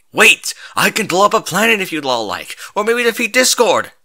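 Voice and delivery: high, loud pleading shout; desperate call. A narrator's reading. Hero pleads with the dispersing crowd, loud desperate shout. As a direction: call after a leaving crowd. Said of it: "he said while trying to catch the crowd".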